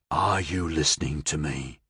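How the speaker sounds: low, soft purring menace; possessed man to Billy Joe, soft and menacing. Acted. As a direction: purr it close to his face. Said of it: "the possessed purred".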